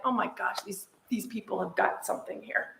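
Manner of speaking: retold awe, said under the voice; hushed realisation. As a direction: drop the volume as if thinking aloud. Retells her hushed realisation.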